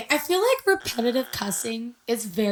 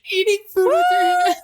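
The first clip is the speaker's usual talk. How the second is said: much higher, louder disbelieving shout; outraged shout; 5 dB louder than usual. Real, not acted. Reading the ick list aloud to her cohost, outraged shout. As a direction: yell the item in shock.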